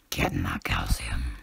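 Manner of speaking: low, slow creepy whisper; scary voice. Pretend. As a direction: whisper it slowly like something scary. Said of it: "That sounded really scary".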